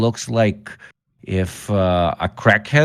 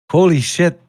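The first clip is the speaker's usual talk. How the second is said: surprised; 8 semitones higher, 5 dB louder than usual. Real, not acted. Realizes the mic works.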